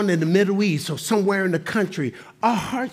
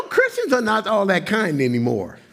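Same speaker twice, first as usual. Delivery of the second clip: ordinary delivery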